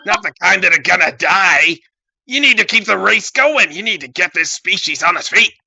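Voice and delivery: high, loud mock-zealot rant; ranting villain. Villain rants about the species.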